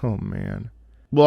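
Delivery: ordinary delivery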